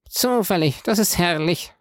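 ordinary delivery, nothing marked

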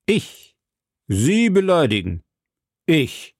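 scornful mock disbelief, high-pitched; contemptuous. A narrator's reading. Lord Winter answers Milady's charge of insult, contemptuously. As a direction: toss the accusation back with disdain, pitch leaping. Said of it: "versetzte Lord Winter verächtlich".

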